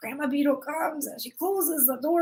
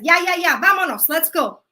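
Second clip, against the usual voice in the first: loud, impatient urging; impatient call; 2 semitones higher, 8 dB louder than usual. Acted. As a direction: hurry her out the door. Death insists on leaving.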